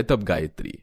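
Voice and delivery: ordinary delivery, nothing marked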